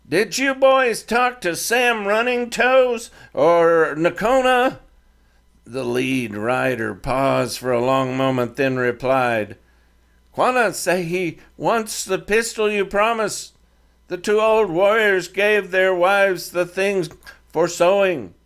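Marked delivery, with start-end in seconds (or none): 0.1-4.8 raised, higher calling out across the ravine; speaking loudly. A narrator's reading. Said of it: "speaking loudly". 10.4-18.3 higher voiced young rider, halting English; a defiant teen speaking halting English; Comanche boy leader.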